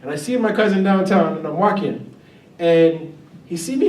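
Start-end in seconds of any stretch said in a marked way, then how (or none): none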